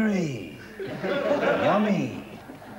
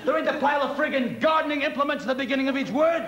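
fast, higher-pitched tirade; exasperated scolding; 5 semitones higher, faster than usual. Acted. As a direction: berate the singer over the accent. Conductor berates singer about accent.

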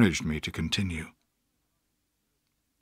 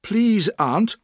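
brave plea; 9 semitones higher than usual. A narrator's reading. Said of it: "I said bravely".

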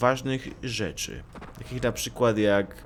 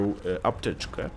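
ordinary delivery

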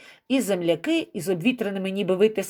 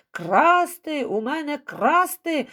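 outraged yelling; 7 semitones higher, much slower than usual. A narrator's reading. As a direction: protest as the one who was robbed. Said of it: "найбільше репетував молодик".

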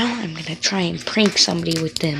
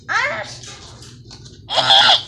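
sharp yelps of pain; pained cries; 11 semitones higher, 4 dB louder than usual. Real, not acted. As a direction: cry out at a stinging cut. Yelps from paper cut.

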